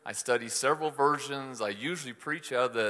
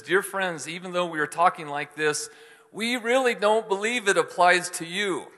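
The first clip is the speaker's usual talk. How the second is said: higher, louder cautioning read; a pastor reading the letter with raised urgency; warning tone; 6 semitones higher, 5 dB louder than usual. Retold or imitated. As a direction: press it home as a warning. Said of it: "That's how he's talking".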